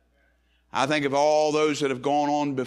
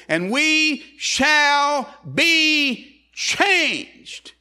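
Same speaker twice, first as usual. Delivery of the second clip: high, very slow, ringing proclamation; drawn-out, fervent preacher's cry; preached climax; 12 semitones higher, 5 dB louder, much slower than usual. Real, not acted. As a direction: hammer out each word from the pulpit. Preacher climaxes a scripture reading.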